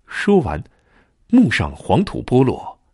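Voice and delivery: ordinary delivery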